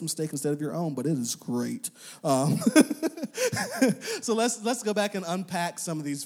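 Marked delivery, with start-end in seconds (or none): 2.6-4.2 short high amused laugh; bright little chuckle; light laugh. Real, not acted. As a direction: laugh at your own aside. Laugh after own joke.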